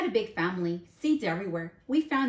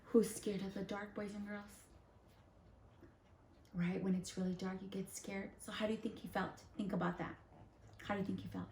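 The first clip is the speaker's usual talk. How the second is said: much softer, gentle hush; 11 dB quieter, faster than usual. Acted. Teacher asking kids about fear of the dark, hushed.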